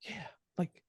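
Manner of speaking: quoting them in a whisper; whispered quote. Retold or imitated. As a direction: hush it like a secret offer. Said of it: "And they were like".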